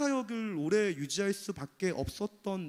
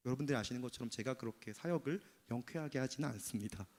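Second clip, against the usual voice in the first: normal talking voice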